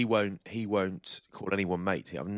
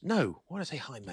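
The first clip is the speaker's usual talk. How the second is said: mock posh reply; 9 semitones higher than usual. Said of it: "quoting posh friend's reply".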